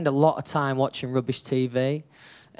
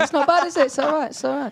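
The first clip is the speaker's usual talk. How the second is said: put-on high-pitched playful Irish accent; 14 semitones higher, 4 dB louder than usual. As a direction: keep the Irish accent going. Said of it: "That's not bad. That's not bad at all".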